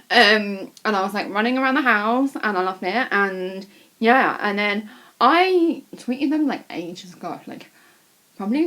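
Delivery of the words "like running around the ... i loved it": ordinary delivery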